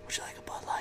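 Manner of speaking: very faint whisper; hushed, voiceless bartender whisper. Acted. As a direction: whisper a drink offer to a customer. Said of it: "bartending would not work whispering".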